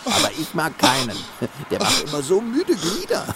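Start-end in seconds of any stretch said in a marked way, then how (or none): none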